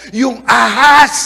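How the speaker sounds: Adam loudly blames the woman God gave him; hammed-up loud excuse; mock shout. Eve blames the serpent.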